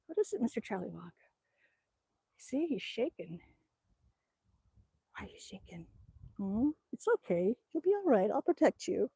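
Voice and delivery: soothing. Real, not acted. Owner comforting her shaking dog, soothing.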